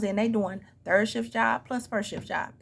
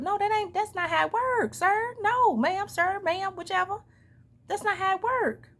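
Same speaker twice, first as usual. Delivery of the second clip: high, pointed exasperation at the other parent; exasperated correction; 9 semitones higher than usual. Real, not acted. Scolding a deadbeat parent, high and exasperated. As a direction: set them straight, incredulous and high.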